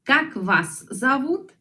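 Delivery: как вас зовут slow, stress on как, rising at the end; slow model question. Shown as a demonstration. (pregunta modelo lenta)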